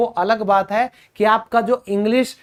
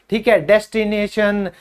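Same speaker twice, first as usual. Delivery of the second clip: ordinary talk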